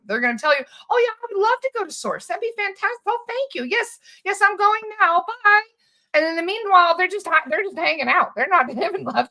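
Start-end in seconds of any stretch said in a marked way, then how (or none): 0.9-5.6 high, quick, insincere gushing; feigned eager agreement. Acted. Reptilian entity bullshits the healer, feigned eager agreement. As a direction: play a reptilian bullshitting with sugary thanks. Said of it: "bullshit you the whole time".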